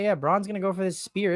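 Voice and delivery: ordinary talk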